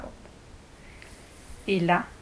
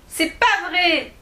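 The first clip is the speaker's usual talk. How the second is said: indignant cry; 7 semitones higher, slower than usual. Acted. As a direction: cry out that it isn't true like a hurt child. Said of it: "s'écria Alice". (s'écrier, indignée)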